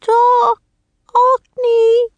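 very high, loud bird squawk; raven croak. A narrator's reading. Captive raven calls to the children, high loud croak. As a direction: screech it like a caged raven. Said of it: "the raven croaked".

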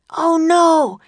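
high, loud cry of dismay; alarmed cry. A narrator's reading. Jack sees riders galloping at them, alarmed cry. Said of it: "cried Jack".

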